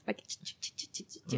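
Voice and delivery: whispered kissy choo noises; kissing sounds. Retold or imitated. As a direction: imitate little dog kisses in a whisper. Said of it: "trying to kiss me".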